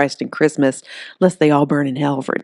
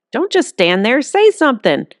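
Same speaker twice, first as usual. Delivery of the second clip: panicked; 8 semitones higher, 4 dB louder than usual. Acted. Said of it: "voice shook with panic".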